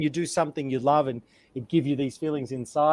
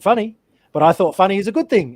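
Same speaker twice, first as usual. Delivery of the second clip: higher, louder surprised question; puzzled alien; 4 semitones higher, 8 dB louder than usual. Pretend. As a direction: sound honestly baffled. Alien puzzled by funny emotions.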